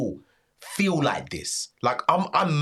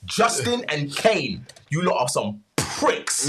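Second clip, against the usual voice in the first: hyped callout; 6 semitones higher, 4 dB louder, slower than usual. Real, not acted. Calling out the rappers.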